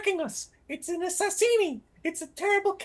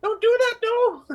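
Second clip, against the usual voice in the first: loud, high plea to stop; panicked shout; 5 semitones higher, 7 dB louder than usual. Acted. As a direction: yell at him to stop, alarmed. Pleading with Trad not to climb.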